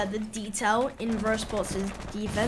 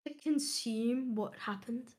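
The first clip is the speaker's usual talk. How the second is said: hushed; 10 dB quieter than usual. Real, not acted. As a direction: drop to a reverent hush. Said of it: "ten seconds of silence".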